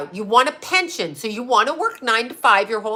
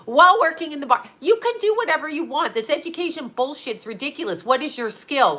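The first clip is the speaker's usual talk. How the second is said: normal talking voice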